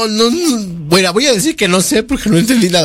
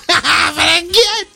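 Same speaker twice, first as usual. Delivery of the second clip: laughing; 13 semitones higher, slower than usual. Real, not acted. Can't understand the child, laughing.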